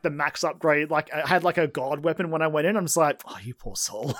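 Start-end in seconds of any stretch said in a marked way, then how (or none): 3.2-4.1 low, soft mock sympathy; condescending sympathy, dropped low and quiet; mock pity. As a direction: pity them in a hushed, lower voice. Pitying the outgunned last boss.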